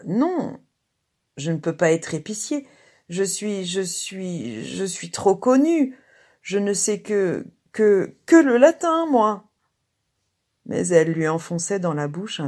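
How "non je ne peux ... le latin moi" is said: flustered protest, building to a loud high cry; stammer, then burst out in refusal; indignant, halting, ever louder; revolt. A narrator's reading. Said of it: "Il eut une révolte".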